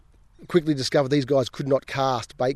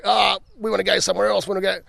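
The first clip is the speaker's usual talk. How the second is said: whiny clients; 5 semitones higher than usual. Retold or imitated. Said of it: "they're like".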